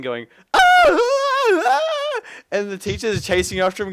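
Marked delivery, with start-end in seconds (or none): 0.5-2.2 piercing high yells, much louder; wild yelling. Retold or imitated. Friend running off the roof with a pipe, wild screaming. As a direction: belt out raw screaming ahs. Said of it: "just yelling".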